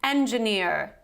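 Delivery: engineer in an American accent with final R; American pronunciation. Shown as a demonstration. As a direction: say engineer the American way, R pronounced at the end. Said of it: "the American version would sound as follows".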